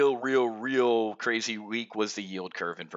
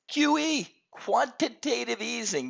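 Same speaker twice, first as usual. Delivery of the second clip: excited; 11 semitones higher, slower than usual. Real, not acted. Announcing JP Morgan's call.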